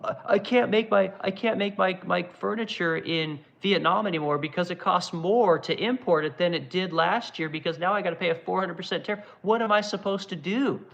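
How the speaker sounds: high, put-on voice of a panicked executive; whiny CEO impression. Streamer mocks a furniture CEO hit by tariffs, high whiny voice. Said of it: "the C E O of Restoration Hardware says".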